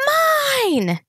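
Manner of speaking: put-on very high, loud toddler screech. A narrator's reading. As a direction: screech with all her lungs. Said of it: "her blinding screams".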